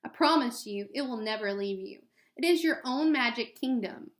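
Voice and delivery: ordinary talk, nothing marked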